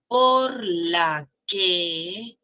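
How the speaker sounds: "Por la que" very slow, each word spaced; drawn out. Shown as a demonstration. Teacher spelling out por la que.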